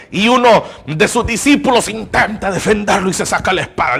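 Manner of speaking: loud, high, impassioned sermon; fervent preaching. Acted. Preacher to his congregation, loud impassioned preaching. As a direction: build the fire of the pulpit. Preacher rising to full force.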